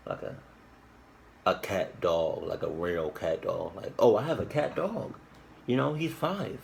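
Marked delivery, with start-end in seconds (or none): 4.0-6.6 mocking high voice. Mocking someone claiming cat dog.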